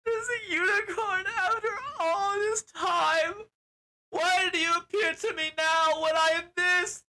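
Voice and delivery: very high, drawn-out hammed-up wail; mock crying. Wife breaking down on seeing the unicorn, mock wailing. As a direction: break down in exaggerated high-pitched sobbing words. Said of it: "she's like, breaks down".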